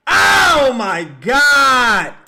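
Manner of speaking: very slow, loud cry of despair; drawn-out anguished yell. Real, not acted. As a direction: stretch it out in pain. Another miss.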